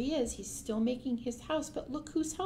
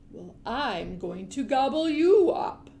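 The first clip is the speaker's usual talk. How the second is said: louder, slow threat to gobble; menacing big fish; 6 dB louder than usual. A narrator's reading. Big fish threatening the troll, slow and loud. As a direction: loom over him and rumble it slowly.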